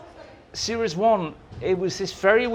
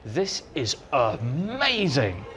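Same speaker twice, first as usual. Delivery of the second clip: hushed awe; 4 semitones lower, much slower than usual. Retold or imitated. Awe walking inside the set.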